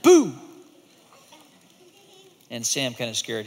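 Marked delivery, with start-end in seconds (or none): 0.0-0.3 sudden loud high boo; jump-scare the friend. Acted. Monster scaring his friend with a loud boo. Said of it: "He said".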